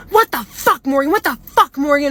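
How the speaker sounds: high-pitched imitation of an outraged scolding; mock angry outburst. Retold angry confrontation at the door.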